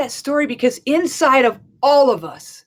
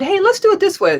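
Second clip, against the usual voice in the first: upbeat offer; 10 semitones higher, 3 dB louder than usual. Retold or imitated. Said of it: "And I said".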